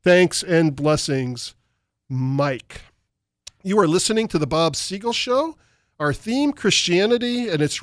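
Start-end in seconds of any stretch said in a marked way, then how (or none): none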